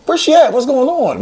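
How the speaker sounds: very high-pitched, baffled questioning; retold bewilderment. Retelling his confusion, no call came.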